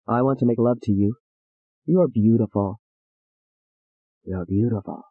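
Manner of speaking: a low, soft father's voice, menacing; father to his daughter, low and soft. Acted. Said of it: "her father said".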